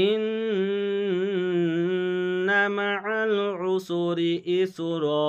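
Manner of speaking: slow melodic chant with long ghunna on ইন্না; Quran recitation. Shown as a demonstration. Teacher reciting the whole verse for students. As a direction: recite the verse slowly and tunefully. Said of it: "আমি পড়ছি এবারে আয়াতটি".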